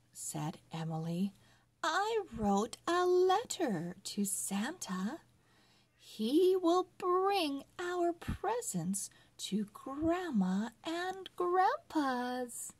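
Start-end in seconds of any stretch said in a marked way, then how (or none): 1.8-12.7 lightly voiced small girl, higher; a kid confidently explaining about Santa; little girl voice. A narrator's reading. Girl Emily reassures brother in story.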